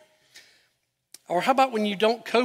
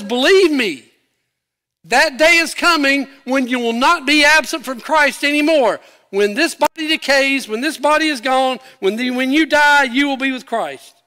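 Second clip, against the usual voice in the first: loud, high, forceful proclamation; God's emphatic promise; 7 semitones higher, 7 dB louder than usual. Retold or imitated. God promising believers they will be with Christ, emphatic. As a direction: preach it at full force as an assurance.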